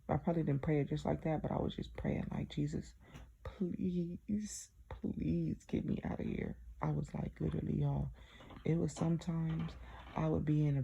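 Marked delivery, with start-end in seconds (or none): 2.5-6.6 soft unvoiced whisper, slow and pleading; begging God under her breath; whispered prayer. Alone in the bathroom, praying to Jesus in a slow whisper.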